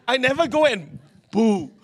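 usual speaking voice

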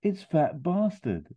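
high, drawn-out exclamation as Austin; exclaiming. Acted. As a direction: cry it out high, stretching the words. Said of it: "Austin literally exclaims".

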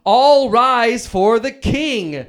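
loud, drawn-out royal proclamation; big announcer call, every word stretched; grand scripted announcement. Acted. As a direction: herald the king's entrance, loud and very slow. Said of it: "it looks like I have to say".

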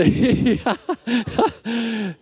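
high bubbling laugh; real laugh at own botched accent; helpless giggle. Real, not acted.

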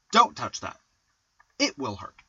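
DON'T touch that, IT will hurt; dactylic stress. Shown as a demonstration. Father improvising dactyls as if warning toddler, dactylic stress. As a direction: stress first of three: "DON'T" and "IT". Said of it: "a stressed followed by two unstressed".

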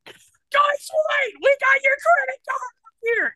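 high shout to a stranger in a sold-out theater; screaming across the room. Retold or imitated. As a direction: yell over a packed theater crowd to find her. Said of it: "top of my lungs, start screaming".

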